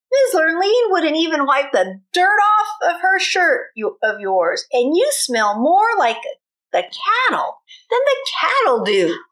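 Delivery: mocking taunt. Stepbrother taunting Bubba about the ball, mocking. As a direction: rub it in with a sneer.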